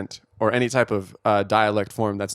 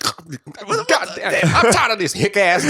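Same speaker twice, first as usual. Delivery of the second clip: loud, high mock Southern grumble; hammed-up Southern exasperation, laughing through it; Southern accent; 10 semitones higher, 5 dB louder, slower than usual. Imitating a fed-up man down South, mock Southern accent. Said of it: "home in the South, and he's like".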